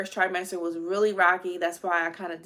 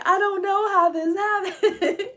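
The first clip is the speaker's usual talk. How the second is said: high, slow, louder bewildered cry; stunned repeating; 13 semitones higher, 6 dB louder, slower than usual. Retold or imitated. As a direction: draw it out, baffled. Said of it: "I kept on saying".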